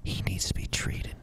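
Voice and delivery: hushed, breathy aside; whispering. Real, not acted. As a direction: whisper it conspiratorially.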